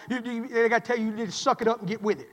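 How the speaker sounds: usual speaking voice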